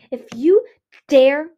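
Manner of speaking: high, loud, halting warning; choked-out threat. A narrator's reading. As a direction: choke out each part with a punch. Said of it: "Ruby choked out".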